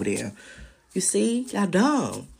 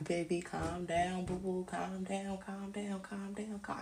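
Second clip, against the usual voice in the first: imitating a hushed, slow calm-down, fading softer and softer; mock soothing; 2 semitones higher, 14 dB quieter, slower than usual. Court insiders mockingly soothing her, softly fading out. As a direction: coo it like soothing a baby, dying away. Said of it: "my people in the suits like".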